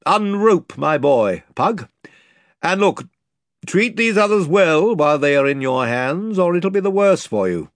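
put-on gentle to the girl, firm to Pug; lordly command. The lord consoling Lucy and warning Pug, commanding.

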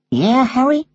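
put-on high parrot voice, American accent. Parrot answering the boy in secret, high and casual.

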